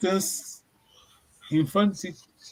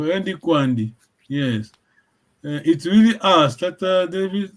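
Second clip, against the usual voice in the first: ordinary talk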